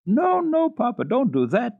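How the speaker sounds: put-on high, loud pleading little girl; begging girl. A narrator's reading. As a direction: plead with Papa not to send her friend away. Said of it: "begged Clara".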